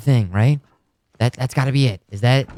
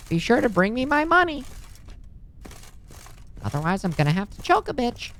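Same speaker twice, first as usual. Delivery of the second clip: high cackly granny, lips over gums; high old lady with no dentures; toothless granny voice; 9 semitones higher, 7 dB quieter than usual. Pretend. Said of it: "Sweet old lady".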